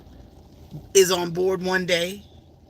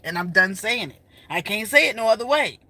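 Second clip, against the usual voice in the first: ordinary talk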